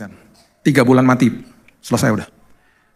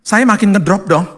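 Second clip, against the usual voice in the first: usual speaking voice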